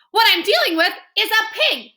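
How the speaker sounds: high, loud, emphatic lady; caller voice. Acted. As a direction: declare it with disgust. Caller reveals pig.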